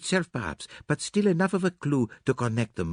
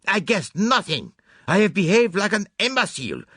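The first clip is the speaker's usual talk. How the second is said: put-on furious self-reproach, high, loud and accented; Poirot; 13 semitones higher, 5 dB louder than usual. A narrator's reading. Poirot berates himself over the lost case, furious outburst. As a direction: rage at yourself, drawing out each exclamation. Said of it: "his anger burst forth freely".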